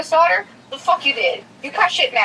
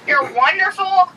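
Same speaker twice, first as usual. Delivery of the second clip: ordinary talk, nothing marked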